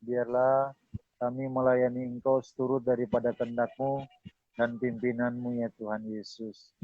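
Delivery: subdued, measured closing prayer; public prayer. Real, not acted. Pastor closing the session before God, prayerful. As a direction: lead them in reverent prayer, phrase by phrase.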